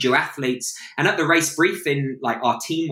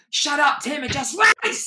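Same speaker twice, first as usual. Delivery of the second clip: high, shouted order to stop talking; mock shout; 10 semitones higher than usual. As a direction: bark it at him mid-race, much higher. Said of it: "And you were like".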